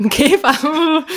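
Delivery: amused, can barely get it out; laughing. Real, not acted. Host echoing the guest's beetle idea, laughing.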